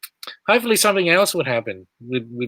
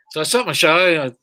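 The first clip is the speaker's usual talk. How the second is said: ordinary talk, nothing marked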